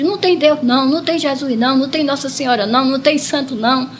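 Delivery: normal talking voice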